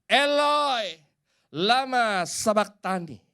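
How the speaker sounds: high, drawn-out acted cry to God; loud cry. Jesus on the cross cries out to God in suffering, loud acted shout. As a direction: call out loudly, high, letting each cry fall away. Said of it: "Dia teriak dengan keras".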